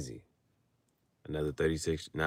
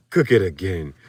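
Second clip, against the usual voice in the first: meme voice; 4 semitones lower, 4 dB louder than usual. Retold or imitated. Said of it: "same voice that you just read it".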